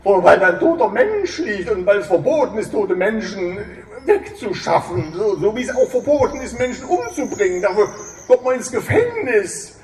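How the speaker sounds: loud, fast and upset; agitated protest. Acted. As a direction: rattle it off in alarm. Manni refuses, agitated.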